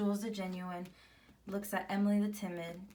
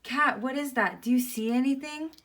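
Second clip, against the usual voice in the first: higher, louder anxious questions; acted alarm; 5 semitones higher, 8 dB louder than usual. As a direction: ask urgently about the strange noise. Worried mouse hears a hiss.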